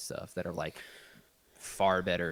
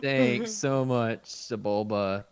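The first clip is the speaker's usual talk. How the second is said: slow and drawn out; 3 semitones higher, 5 dB louder, much slower than usual. Acted. Thanks Sebulba slowly, drawn out.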